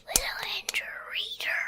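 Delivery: breathy quick whisper of the show name. Acted. Host whispering the channel name to young listeners, whispered. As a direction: whisper it fast like a secret.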